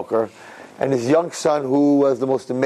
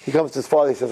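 usual speaking voice

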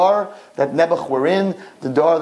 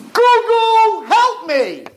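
high desperate scream; screaming for help; 5 dB louder, much slower than usual. Acted. King in the boiling pot screaming for help. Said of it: "he started to scream the name".

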